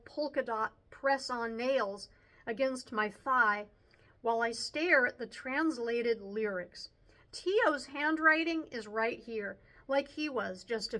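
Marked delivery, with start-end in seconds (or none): none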